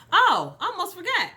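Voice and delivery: high, bright oh I almost forgot; suddenly remembering something; sudden realization. Real, not acted.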